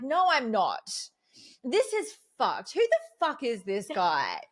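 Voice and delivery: a touch of distraught indignation; upset outburst. Retold or imitated.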